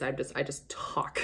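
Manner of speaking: soft, whispery aside; hushed trail-off. Real, not acted. Vlogger admitting all she does is talk, hushed. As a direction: let it fade to a whisper.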